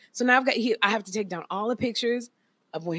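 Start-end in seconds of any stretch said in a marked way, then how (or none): none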